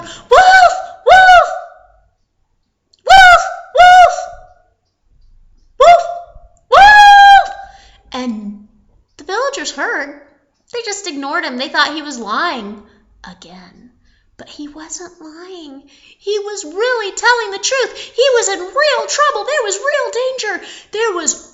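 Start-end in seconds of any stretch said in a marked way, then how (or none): 0.0-7.6 very high, very loud repeated screams; full-out alarm cries; desperate yelling. Acted. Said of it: "So he yelled". 13.2-15.9 soft, near-whispered aside; hushed storyteller's suspense; dramatic hush. A narrator's reading. Hushed narration at tense moment. 15.9-20.7 high, insistent storytelling; raised, alarmed narrator voice; urgent narration. A narrator's reading. Narrator builds urgency of danger.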